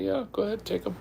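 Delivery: lightly acted, high-pitched go-ahead; breezy permission. Acted reply giving the kids permission.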